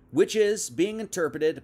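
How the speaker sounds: usual speaking voice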